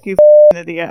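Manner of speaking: sudden loud insult; loud shout. Retold or imitated. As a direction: blurt it out loud. Friend voiced scolding Ulrich.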